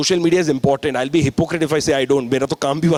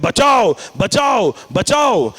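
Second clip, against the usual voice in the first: storyteller calling out in pain, half-acted; cry for help; 3 semitones higher than usual. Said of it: "Gurudron पीड़ा में चिल्लाते हैं".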